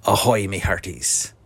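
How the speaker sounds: lightly voiced pirate sign-off; a touch of pirate talk. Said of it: "Talk Like a Pirate Day".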